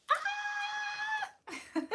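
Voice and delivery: very high delighted squeal; a sudden excited squeak. Real, not acted. Streamer squealing at chat's compliment, delighted.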